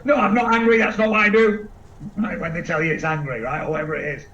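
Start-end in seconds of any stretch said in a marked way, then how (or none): 0.0-1.7 louder, higher mock protest; mock indignant pushback; defensive denial. Speaker mocking someone denying they're angry, louder indignant protest. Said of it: "Don't turn around and go".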